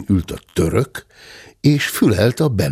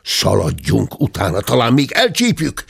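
usual speaking voice

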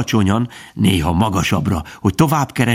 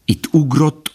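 usual speaking voice

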